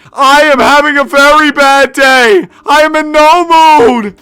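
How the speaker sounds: hammed-up Stephen A Smith bellow; Stephen A Smith rant. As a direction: bellow it like a TV pundit. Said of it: "what did Stephen A Smith say?".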